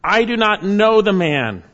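loud, high angry denial; fierce, loud denial with an oath; cursing denial. Acted. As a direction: hurl the denial like a curse. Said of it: "Then he began to curse and swear".

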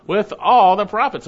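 ALL punched out loud; stress on ALL. Shown as a demonstration. Preacher correcting "a few" for the congregation, emphatic ALL. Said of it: "He didn't say a few of them".

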